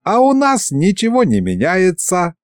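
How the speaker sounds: high-pitched, squeaky dragon head; cheerful squeak. A narrator's reading. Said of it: "жизнерадостно пропищала левая".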